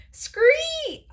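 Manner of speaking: very high, loud, drawn-out scree; one piercing frog shriek; frog screech. A narrator's reading. Frog still rescuing his friend, screeching. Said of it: "Og repeated".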